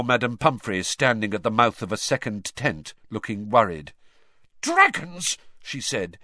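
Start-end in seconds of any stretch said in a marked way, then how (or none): 4.6-5.4 high, scornful exclamation; disgusted. A narrator's reading. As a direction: spit the word out in disgust. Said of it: "in a disgusted tone".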